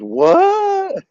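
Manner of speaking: loud, sky-high mock "what?"; hammed-up double take, shriek-high and drawn out; imitating a flabbergasted onlooker; shocked reaction. Acts out locals' bigger double take.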